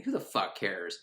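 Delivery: usual speaking voice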